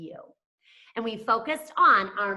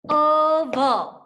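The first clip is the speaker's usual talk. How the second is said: Oval higher and louder, two clear beats; syllables set apart; 6 semitones higher, 7 dB louder than usual. Shown as a demonstration. Teacher sounding out Oval for the class. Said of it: "has its own syllable".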